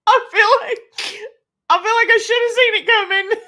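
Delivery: high-pitched laughing through words. Real, not acted. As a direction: talk while cracking up. Laughing at dad joke.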